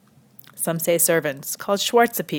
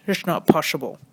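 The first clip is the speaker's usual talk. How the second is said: put-on comic Dutch accent refusal; 3 semitones lower than usual. Comedian quoting the phrase heard over and over, Dutch accent. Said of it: "my Dutch accent is so amazing".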